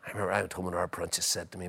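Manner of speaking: ordinary talk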